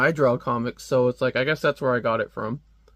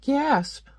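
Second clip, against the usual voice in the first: much higher mock gasp word; spoken gasp; 12 semitones higher than usual. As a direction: read out gasp dramatically. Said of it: "she's like".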